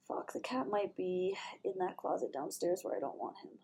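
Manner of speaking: worried whisper. Real, not acted. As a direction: whisper it under the breath, alarmed. Sudden worry about cat.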